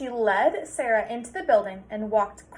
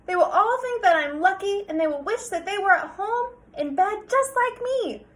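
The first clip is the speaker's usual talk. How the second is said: higher, louder sassy reply; smug comeback; cheeky child; 9 semitones higher, 6 dB louder than usual. Acted. Sarah answering her father back, high and cheeky.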